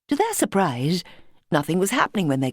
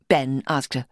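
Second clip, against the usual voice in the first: usual speaking voice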